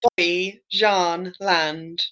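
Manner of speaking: very slow, "Bobby John Land" broken into separate words; slow pronunciation demo. Shown as a demonstration. Repeating the park's name for puzzled co-hosts, very slow demo. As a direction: spell out "Bobby John Land" by ear, word by word. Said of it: "no idea how to say it".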